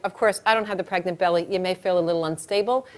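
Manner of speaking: usual speaking voice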